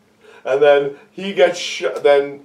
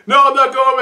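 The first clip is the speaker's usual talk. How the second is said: lightly voiced high yelp; high defiant refusal; 9 semitones higher, faster than usual. Voicing Waingro refusing.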